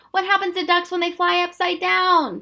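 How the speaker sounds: high, loud call; crying out. Acted. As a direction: cry the riddle out. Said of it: "Tom cried".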